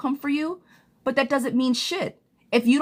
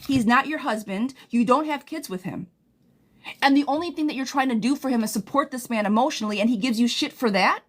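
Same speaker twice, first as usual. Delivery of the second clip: ordinary delivery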